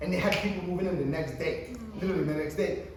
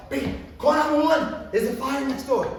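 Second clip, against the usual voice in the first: urgent call to wife; 7 semitones higher, 5 dB louder than usual. Retold or imitated. Telling his wife about the neighbor's fire, urgent and loud. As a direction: tell her to call for help right now. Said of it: "I tell my wife".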